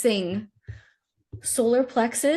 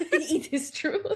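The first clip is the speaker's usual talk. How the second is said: giggling through the words; laughing; 8 semitones higher, 4 dB quieter, slower than usual. Real, not acted. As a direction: laugh it out, slowly. Repeating the joke, laughing.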